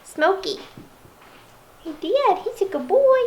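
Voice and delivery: high praising puppy talk; talking to a dog. Real, not acted. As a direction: gush over the puppy.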